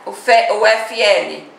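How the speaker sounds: normal talking voice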